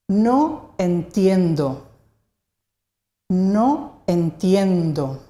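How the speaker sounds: "No entiendo" drawn out slowly, a gap between the words; slow model phrase. Shown as a demonstration. Teacher models phrase for learners.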